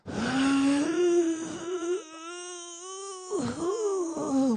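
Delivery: long, high groan of effort; acted heaving groan, held long; straining push. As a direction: wail through an enormous shove. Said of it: "pushed as hard as he could".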